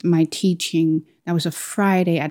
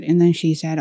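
ordinary talk, nothing marked

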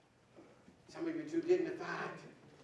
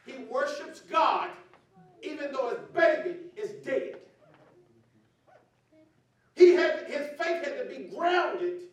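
preaching at full force; 9 semitones higher, 10 dB louder than usual. Acted. Pastor shouting to the congregation about David's faith, fervent preaching. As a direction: lift the congregation with a shouting sermon.

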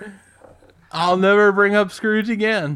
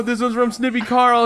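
ordinary talk, nothing marked

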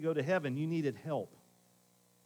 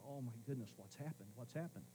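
hushed worry; 4 semitones lower, 15 dB quieter than usual. Retold or imitated. Worried inner thought after hang-up.

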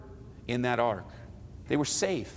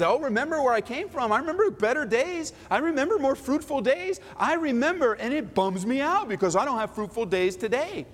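high, mock nostalgic whine; 8 semitones higher than usual. Christians longing for past days, mock whine. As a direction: moan about better days up high. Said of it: "So many Christians".

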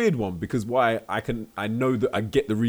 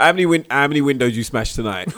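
bouncer questioning; 4 semitones higher, 7 dB louder than usual. Pretend. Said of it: "He's like".

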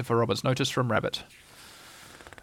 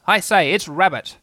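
raised, louder call; shouted announcement; 5 semitones higher, 5 dB louder than usual. A narrator's reading. Rabbit shouts up at Christopher Robin's tree, calling out. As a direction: call out for someone to hear. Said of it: "he shouted".

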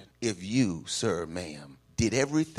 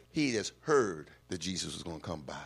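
ordinary talk, nothing marked

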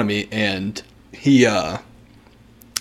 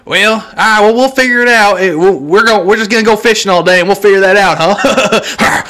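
put-on Southern accent; 7 semitones higher, 4 dB louder than usual. Mocking the man's phone call, a loud Southern accent. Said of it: "speak like they're from the panhandle".

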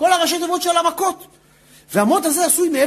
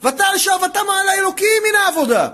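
high, raised-pitch outcry; crying out; 6 semitones higher than usual. Retold or imitated. Preacher retells Israel crying out under the decree, high outcry. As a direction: lift the verse into an imitated cry to heaven. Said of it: "התחילו לצעוק".

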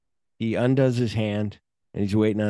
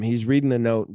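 ordinary delivery

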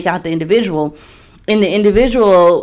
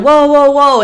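startled whoa; 7 semitones higher, 4 dB louder than usual. Retold or imitated. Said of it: "he was like".

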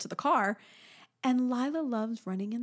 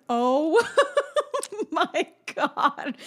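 laughing amazement; 7 semitones higher, 4 dB louder, much slower than usual. Real, not acted. Parents amazed.

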